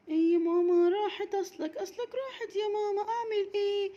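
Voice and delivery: put-on high, quick whining child; a small girl making excuses; whiny little girl. Raghad excusing herself to mama, high whiny child voice.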